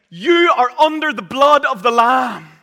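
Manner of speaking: loud, high, ringing proclamation of the Lamb; full-force preached declaration; proclaimed. Acted. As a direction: declare it to the whole church. Proclaimed versus quiet repeat.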